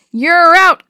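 loud, high umpire's call. A narrator's reading. Umpire rules the batter out, calling loudly. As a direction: belt out the ruling. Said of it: "Willie called".

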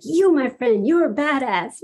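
lightly acted hearty congratulation; emphatic praise. Pastor praising her after a hug, retold with emphatic admiration.

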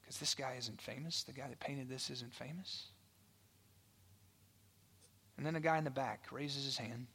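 normal talking voice

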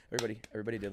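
ordinary talk

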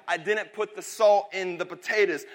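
ordinary talk, nothing marked

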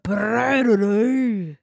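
put-on a rolled trill poking fun at the Scottish accent; half-joking Scottish brrr; mock Scottish rolled r. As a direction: roll a long playful Scottish r. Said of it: "It's just like".

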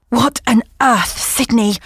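out of breath and startled; breathless, just ran in. Acted. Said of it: "breathless and tousled looking".

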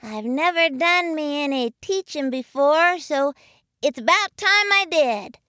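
high, small youngster's voice; little kid voice. A narrator's reading. Young muskrat talking about a first go at teaching, little high voice. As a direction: pitch it way up for a little one. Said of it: "a little voice belonging to Jimmy Bobby".

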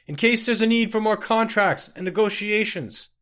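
loud, high calling over distance; yelling from afar. Acted. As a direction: yell back to the departing carriage. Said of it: "yelled Laides Eurus".

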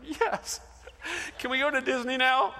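put-on high, gleeful child with a laugh; excited kid. Son cheering at the end of the fight, high and gleeful. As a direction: cheer like a delighted little boy. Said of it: "And Taylor's like".

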